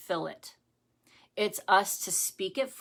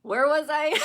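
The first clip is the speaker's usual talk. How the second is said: high, laughing and louder; real giggling, derailed; 13 semitones higher, 4 dB louder than usual. Real, not acted. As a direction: laugh while losing your place. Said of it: "That's why I'm laughing".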